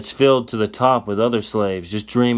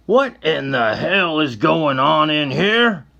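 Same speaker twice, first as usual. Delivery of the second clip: demanding cry; 6 semitones higher, slower than usual. Acted. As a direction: storm in and demand answers. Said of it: "he cries out".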